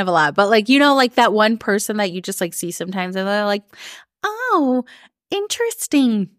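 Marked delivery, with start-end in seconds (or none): 4.2-6.3 high, sing-song Minnesota nice "Oh, interesting"; polite Midwestern passive judgment, pitched way up. Retold or imitated. Mimicking an acquaintance who judges politely, in high Minnesota nice. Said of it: "Minnesota nice".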